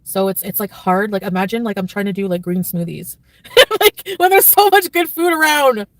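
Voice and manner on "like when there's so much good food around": laughing through the words, much higher and louder; giggling at her own diet. Real, not acted. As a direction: burst out laughing at the thought. Laughing at her own green smoothies.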